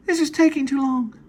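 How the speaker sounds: very high, thin character voice; squeaky voiced woman. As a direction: pitch way up for the woman's line. Woman voiced in read screenplay.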